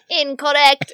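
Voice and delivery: threatening robot woman. Retold or imitated. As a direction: do a slightly threatening computer voice. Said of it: "in that slightly threatening robot woman voice".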